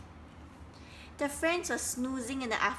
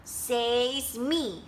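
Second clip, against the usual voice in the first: snapped; 3 dB louder than usual. Acted. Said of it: "snapped Duck".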